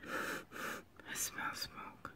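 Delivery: slow worried whisper. Real, not acted. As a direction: whisper it slowly, sniffing. Repeated in whisper.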